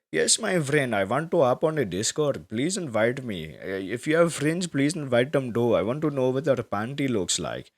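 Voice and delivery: hammed-up Indian accent, eager. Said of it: "I'm gonna do it".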